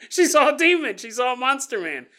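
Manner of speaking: high, loud oh-yeah discovery; excited realization. Retold or imitated. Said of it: "I was like".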